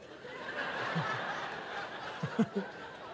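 long soft airy chuckle; breathy laugh. Real, not acted. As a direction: laugh quietly at the awkward moment. [name] sees him with a woman.

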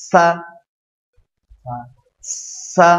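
সা with a thin, light hissing s; a fine narrow s in সা. Shown as a demonstration. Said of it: "চিকন করে শব্দ করুন". (চিকন করে সা)

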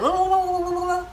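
high gobbling whoas like a turkey; turkey gobble. Retold or imitated. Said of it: "like a turkey".

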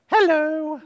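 high sing-song hello; a bright greeting pitched high; high hello for Mary. Shown as a demonstration. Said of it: "you say".

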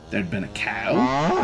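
ordinary delivery

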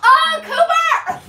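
high, loud, slow dismayed squeal; exasperated squeal. Real, not acted. Owner squealing as the dog wrecks her hair, high and loud.

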